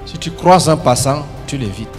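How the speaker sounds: ordinary talk, nothing marked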